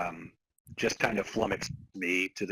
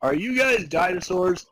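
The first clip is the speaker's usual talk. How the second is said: high, louder suspicious question, hammed up; mock guard questioning; 8 semitones higher, 6 dB louder than usual. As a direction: play a goofy gatekeeper grilling intruders. Acting out dinosaur guard dialogue.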